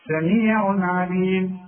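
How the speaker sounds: سميع عليم recited slowly with no hum on the tanween; slow chant of سميع عليم, clear n before ع. Shown as a demonstration. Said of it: "اسمه إظهار". (سميع عليم بتنوين مظهر)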